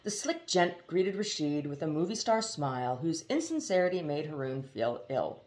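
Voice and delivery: ordinary delivery, nothing marked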